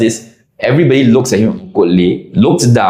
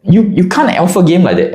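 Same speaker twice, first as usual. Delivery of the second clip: mock protest; 7 semitones higher, slower than usual. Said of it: "me and the other gamers were like".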